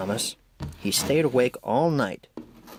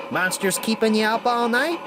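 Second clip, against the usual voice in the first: teasing call; 11 semitones higher than usual. A narrator's reading. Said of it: "he called".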